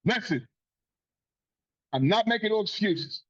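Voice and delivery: ordinary talk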